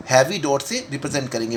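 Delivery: usual speaking voice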